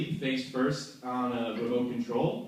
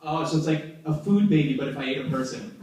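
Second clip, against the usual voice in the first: normal talking voice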